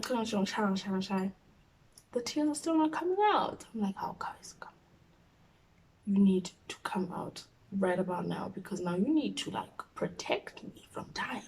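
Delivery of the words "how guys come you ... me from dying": hushed whispered plea. Retold or imitated. Begging her own tears to come, whispered. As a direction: whisper urgently to yourself.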